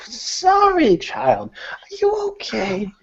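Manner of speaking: put-on high, creaky old woman fussing; high cranky old voice, half laughing. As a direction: play a cranky old lady fretting over a fallen child. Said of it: "a cranky old voice saying".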